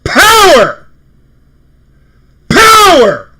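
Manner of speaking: high, full-voice bellow; triumphant shout. Real, not acted. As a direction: belt it out as a jubilant rallying cry. Overjoyed fan bellowing on show.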